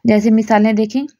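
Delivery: normal talking voice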